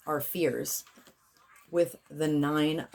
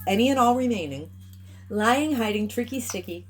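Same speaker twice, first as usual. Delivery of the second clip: ritual incantation; 8 semitones higher than usual. Acted. Healer proclaims the clearing to the collective, raised invocation voice. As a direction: command the energy like a spell.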